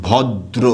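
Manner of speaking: ভদ্র said as bhod-dro with a doubled d and a final o; ভদ্র with the d doubled and an o ending; pronounce bhoddro. Shown as a demonstration. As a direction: teach ভদ্র as bhoddro, the d held and an o at the end. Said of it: "তখন এটার উচ্চারণটা কি হবে".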